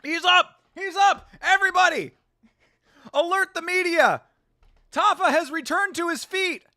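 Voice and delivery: high, loud burst of joy; hyped commentator yelling; excited shout. Real, not acted. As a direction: shout it like he just rose from the dead. Tafa stands back up.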